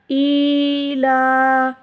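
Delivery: ई ला with both vowels held long; drawn-out recitation. Shown as a demonstration. Teacher joining the letters for pupils, drawn out. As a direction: chant ई ला slowly. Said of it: "लाम खड़ी ज़बर ला".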